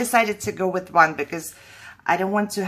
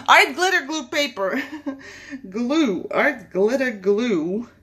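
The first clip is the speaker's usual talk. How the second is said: giggling over a tongue-twister name; laughing through words; 4 semitones higher than usual. Real, not acted.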